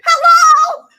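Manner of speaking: screamed, very high and loud; a shrieking mock wake-up call; exasperated shout. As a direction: yell it at her in disbelief. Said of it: "I'm like".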